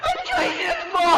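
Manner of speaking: very high frantic cries; panicked calling. Acted. Wife screaming as husband collapses, terrified calls. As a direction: scream his name in terror.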